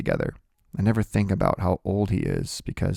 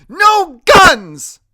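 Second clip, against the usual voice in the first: very high, full shout; grandmother's piercing shout; shouted protest; 14 dB louder than usual. A narrator's reading. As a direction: forbid it at a yell.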